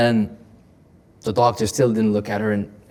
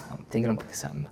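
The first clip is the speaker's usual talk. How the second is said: ordinary delivery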